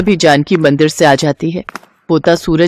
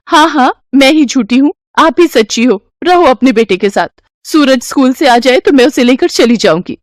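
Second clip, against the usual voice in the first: higher, mocking and hurt; sarcastic daughter-in-law; 6 semitones higher than usual. Daughter-in-law mocking her mother-in-law, higher. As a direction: twist the knife sarcastically.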